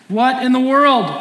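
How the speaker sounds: loud, high "What in the world?" exclaimed; mock-shocked crowd, loud and high; incredulous outburst. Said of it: "And the crowd's going".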